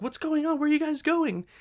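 high-pitched imitation of a mother; mock worried mom, voice way up; mom's voice. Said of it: "my mom was like".

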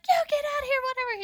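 high, loud charging yell, imitated; Rita. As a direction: chase someone off in a crackhead shriek. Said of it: "So she charges".

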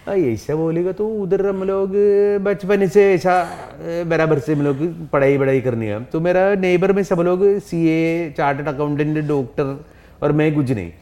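high, fast Malayali accent in Hindi. Shown as a demonstration. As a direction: put on a thick Malayali accent. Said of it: "do a Malayali accent".

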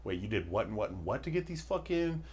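hammed-up disbelieving listener; incredulous listener. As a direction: play a baffled skeptic. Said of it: "some people are probably like".

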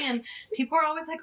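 ordinary talk, nothing marked

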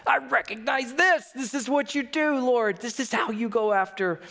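praising God with emotion; choked-up awe. Real, not acted. Preacher addressing the Lord, moved and rising. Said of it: "I get choked up".

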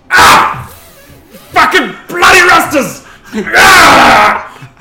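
full-force shout of agony, high and loud; sudden explosive, high screamed cursing; pained bellow. Acted. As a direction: roar curses through the pain. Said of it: "calmly, quietly".

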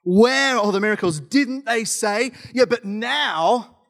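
high, stretched-out sassy protest; exaggerated frustrated complaint; sassy frustration. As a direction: draw out each challenge, peeved. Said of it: "Gideon sounds frustrated".

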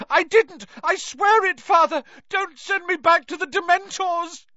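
high desperate shriek. A narrator's reading. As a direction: scream in terror at father. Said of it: "shrieked the boy".

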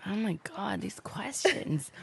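whispered, these questions slowed down; exasperated whisper. Real, not acted. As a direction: mutter it under your breath. Quiet aside to herself.

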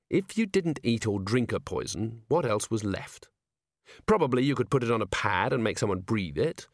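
ordinary delivery, nothing marked

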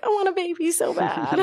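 high, whiny pretend longing; mock sad whine. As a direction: whine it like a mock pity party. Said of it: "I'll be all sad and be like".

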